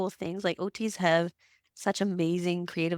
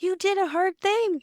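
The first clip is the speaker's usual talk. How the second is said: put-on a child's voice with a different timbre, much higher and a little louder; imitating her proud son; 12 semitones higher, 4 dB louder than usual. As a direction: play the boy praising his mom. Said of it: "he's like".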